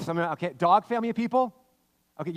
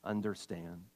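Jesus voiced; 6 semitones lower, 7 dB quieter, slower than usual. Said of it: "He said to them".

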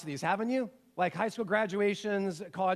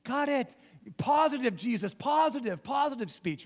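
lightly acted dean cutting in, higher pitched; alarmed dean; 5 semitones higher than usual. Dean stops Jesus mid-speech, mock urgent interjection. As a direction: interrupt with short, urgent corrections. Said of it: "the dean over there being like".

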